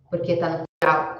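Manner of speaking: usual speaking voice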